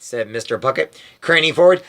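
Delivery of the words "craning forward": usual speaking voice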